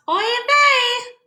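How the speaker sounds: shrill high oy vey of a worried mother; cried out oy vey. Acted. Mama Gefilte crying out for her lost children, high wail. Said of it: "Mama Gefilte cried out".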